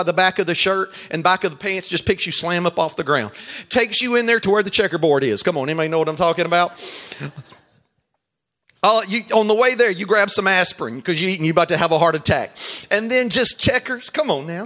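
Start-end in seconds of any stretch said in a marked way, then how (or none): none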